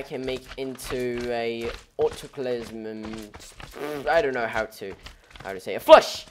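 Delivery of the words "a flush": sudden outburst. Real, not acted. Blurting a made-up word.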